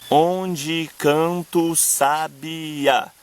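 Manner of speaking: very slow, syllables drawn apart; scanned verse. Shown as a demonstration. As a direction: tap out each poetic syllable. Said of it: "Repare bem, canta-o, eu falo junto".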